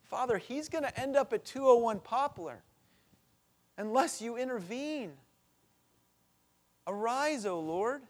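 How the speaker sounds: urgent cried-out prayer; pleading prayer. Retold or imitated. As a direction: beg God to step in. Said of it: "I look at this boy and I go".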